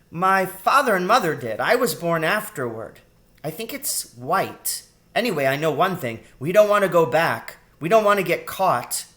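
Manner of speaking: put-on high, loud child's voice; insistent girl rat. A narrator's reading. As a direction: chatter emphatically like a little girl.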